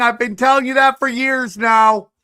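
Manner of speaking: high, loud and slow conspiracy warning; hammed-up paranoid outburst; mock alarmed rant. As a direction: proclaim it like a doomsayer.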